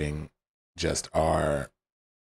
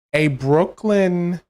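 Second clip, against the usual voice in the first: slow, higher, louder imitation of the game's Brooklyn-Italian accent, drawing out Brooklyn; mock Brooklyn accent; 11 semitones higher, 7 dB louder, much slower than usual. Player mocking Mario's Brooklyn accent, slow and exaggerated. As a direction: parody the game's Brooklyn voice, stretching Brooklyn slowly. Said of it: "Italian accent does not sound like".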